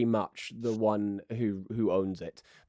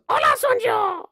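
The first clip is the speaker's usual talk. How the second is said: very high, loud granny greeting; piercing high grandma voice; old grandma; 6 dB louder than usual. Acted. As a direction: holler hello back as a bossy old woman. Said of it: "And she goes".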